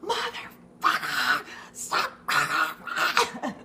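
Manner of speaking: slow strained yell at the dog; screaming profanity. Retold or imitated. Owner yelling at his dog over a ham hock, strained slow scream. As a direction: bellow it while wrestling a dog. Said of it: "I'm screaming".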